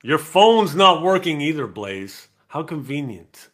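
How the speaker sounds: loud, high teasing scold; loud playful telling-off of a friend; mock scolding. Real, not acted. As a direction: scold him for the whole chat to hear. Scolding absent friend on stream.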